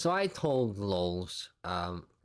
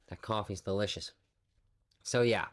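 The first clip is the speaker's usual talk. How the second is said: ordinary delivery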